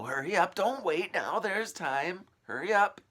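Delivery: high, loud urgent urging; urgent hurry. A narrator's reading. Old man sending James off, loud and urgent. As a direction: shoo the boy off in a rush.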